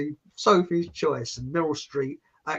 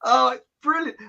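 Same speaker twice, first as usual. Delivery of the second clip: usual speaking voice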